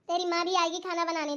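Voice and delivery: ordinary talk, nothing marked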